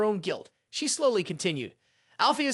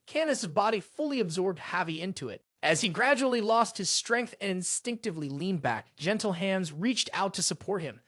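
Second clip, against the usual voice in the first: ordinary delivery, nothing marked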